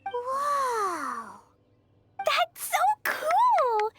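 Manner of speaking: high, slow, wide-eyed wonder; drawn-out gasp of delight; amazed. Acted. Kids amazed by dandelion wine.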